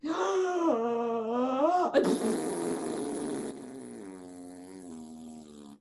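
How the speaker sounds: long hard blow out. Shown as a demonstration. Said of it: "You need to blow like this".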